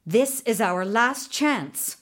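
usual speaking voice

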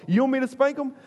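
high-pitched, over-eager volunteering; eager offer. Retold or imitated. New dad to parents of misbehaving kids, high eager offer. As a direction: pitch it way up, mock helpful.

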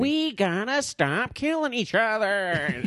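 hammed-up presidential impression, slow and high; Abe Lincoln. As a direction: stretch every word in a mock-historical oratory voice. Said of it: "he's doing an impression".

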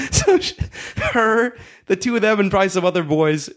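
ordinary delivery

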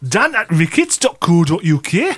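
broad Geordie email address; Geordie, with a rising finish; Geordie style. Shown as a demonstration. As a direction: read it out in thick Geordie. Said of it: "I could do it in Geordie style".

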